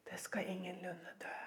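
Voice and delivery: sly serpent whisper. Acted. Preacher voicing the serpent's lie in a whisper. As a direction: hiss it softly like the snake. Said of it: "Så sier han".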